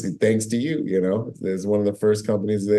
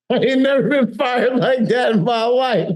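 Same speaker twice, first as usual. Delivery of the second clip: laughing disbelief; 11 semitones higher than usual. Real, not acted. Laughing about getting fired.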